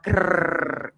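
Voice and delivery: long rolled mock growl; playful little-dog growl; dog growl imitation.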